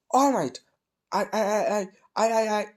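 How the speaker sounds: high nervous stammer, repeating I; a nervous boy stammering at his hero; fanboy stammer, voice jumping up; stuttering. Acted. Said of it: "okay, I'm not actually stuttering".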